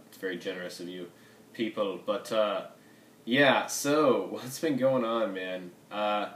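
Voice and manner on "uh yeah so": ordinary delivery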